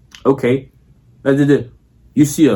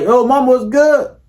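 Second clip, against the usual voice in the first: higher, drawn-out smooth greeting; showing how to greet Spanish girls; casual pickup line; 9 semitones higher, slower than usual. Shown as a demonstration. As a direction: toss it out while walking by. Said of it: "you're like".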